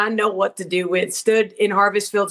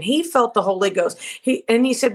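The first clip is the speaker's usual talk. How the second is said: ordinary talk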